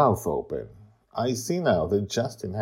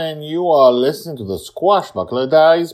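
loud, high podcast host intro; big show-opening announcement; announcer voice; 12 semitones higher, 12 dB louder than usual. Acted.

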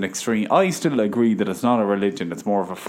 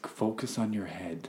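very soft, slow instruction; soft narrator voice; 13 dB quieter, slower than usual. Retold or imitated. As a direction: lull them like a meditation guide. Imitating body scan narrator.

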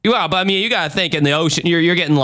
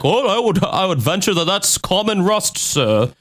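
higher, prim expert voice correcting him; polite expert; 7 semitones higher than usual. Retold or imitated. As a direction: gently correct the investigator like a fussy expert. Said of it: "And he's like".

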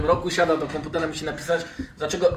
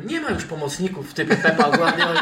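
laughing; 4 semitones higher, 3 dB louder than usual. Real, not acted. Laughing joke about midfielders.

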